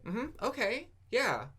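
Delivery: imitated high, rising acknowledgment; assistant's filler responses. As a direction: act out natural listening noises, pitched high. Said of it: "the Google Assistant said".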